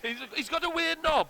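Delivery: very high Welsh lilt; squeaky hammy Welsh; Welsh accent. Pretend. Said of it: "do your Welsh accent".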